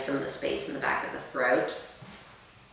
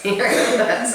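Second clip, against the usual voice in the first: louder, laughing reminder; laughing correction; 7 semitones higher, 6 dB louder, slower than usual. Real, not acted. Mother laughing as she corrects children back to hero pose. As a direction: laugh while you call it out.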